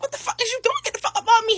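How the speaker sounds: high shrill-free protest, pulling away; high indignant yank away; startled protest. Retold or imitated.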